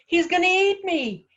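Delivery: high, loud terrified wail; acted shriek of panic; panicked scream. A narrator's reading. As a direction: wail it as if being chased. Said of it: "Poppet screamed".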